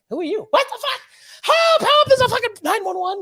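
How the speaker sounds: high, loud panicked cries for help; mock panic. Hammed-up panic screaming for help. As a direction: squeal for help like a scared victim.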